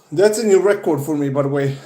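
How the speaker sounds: panting out of breath after push-ups; out of breath, gasping between words. Real, not acted. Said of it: "I'm out of breath".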